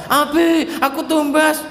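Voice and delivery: put-on high child asking dad; a kid asking for a treat.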